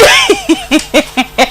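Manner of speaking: high, giggly burst of laughter; real laughter. Real, not acted. Host laughs at own remark.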